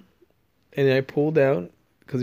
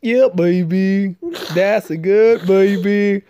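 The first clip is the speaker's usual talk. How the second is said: bad English accent gone Vietnamese, loud and drawn out; hammed-up, slow English accent with a Vietnamese slant; Austin Powers; 3 semitones higher, 5 dB louder, much slower than usual. Shown as a demonstration. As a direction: stretch out a botched English accent, louder. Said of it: "it sounds like a Vietnamese Austin Powers".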